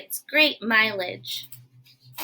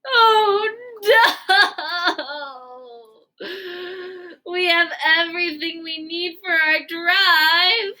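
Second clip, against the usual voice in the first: hammed-up wailing despair; 3 semitones higher, slower than usual. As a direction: wail it out like the world just ended. Gerald despairs over ruined plans.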